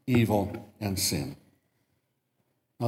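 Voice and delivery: ordinary talk, nothing marked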